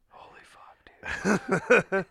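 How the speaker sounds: slow, voiceless gasp of dismay; stretched-out embarrassed whisper; mortified whisper. Retold or imitated. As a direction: whisper it as you sink down. Retelling sinking down in embarrassment.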